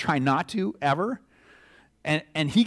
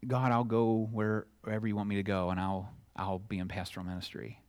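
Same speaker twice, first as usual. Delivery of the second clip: surrendered prayer; 5 semitones lower, 7 dB quieter than usual. Retold or imitated. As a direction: hush down to a surrendered murmur, trailing away. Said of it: "I'm like".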